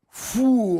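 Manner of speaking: a high, loud "phoo" for a swinging plank; mock whoosh. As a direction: blow out a sharp, high swing sound. Dad swings over fence, mock whoosh.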